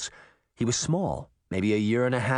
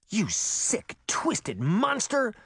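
high, slow, drawn-out contempt; controlled fury, pitched up; seething; 8 semitones higher, much slower than usual. A narrator's reading. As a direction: seethe each word at an enemy. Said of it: "Sabrina seethed at Pinocchio".

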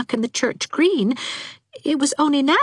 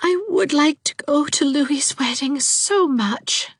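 slow, high, breath-filled wistful sigh; high, half-whispered wish, drawn out slowly; despairing resignation; 11 semitones higher, slower than usual. A narrator's reading. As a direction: sigh out a hopeless longing. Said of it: "with a long sigh of despairing resignation".

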